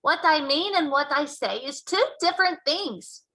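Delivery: loud, high, grand announcement; grandly. Acted. Giant lecturing Sophie, grandly. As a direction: proclaim it with pomp. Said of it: "announced rather grandly".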